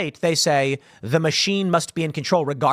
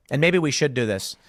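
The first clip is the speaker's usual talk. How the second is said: usual speaking voice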